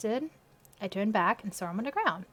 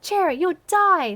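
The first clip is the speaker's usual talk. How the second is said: alarmed; 8 semitones higher, 9 dB louder, slower than usual. Acted. Alarmed friend.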